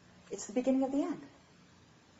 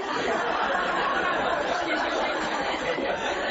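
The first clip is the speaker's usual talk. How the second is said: long breathy laugh; amused laugh; 8 semitones higher than usual. Real, not acted. Laughter after joke.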